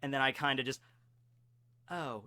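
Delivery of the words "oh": small, soft, low reply; deflated. Retold or imitated. Student told he placed second, quiet deflated reply. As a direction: let it drop quietly in disappointment.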